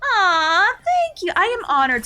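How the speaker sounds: touched squeal. Real, not acted. Touched by a viewer's compliment.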